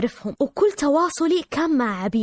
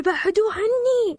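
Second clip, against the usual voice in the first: high, drawn-out plea for help; retold crying scream; 10 semitones higher, slower than usual. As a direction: cry out slowly, much higher, without real shouting. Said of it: "أصرخ وأبكي وأقول".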